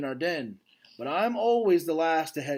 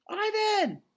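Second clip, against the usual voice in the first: put-on girl's yell. Girl calling to the gorilla across the moat, a high yell. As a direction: shout his name to reach him. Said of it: "the girl yells".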